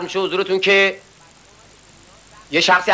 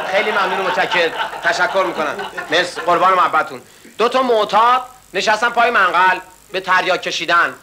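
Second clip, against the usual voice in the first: ordinary talk, nothing marked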